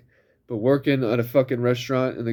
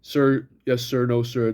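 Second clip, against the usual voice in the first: ordinary delivery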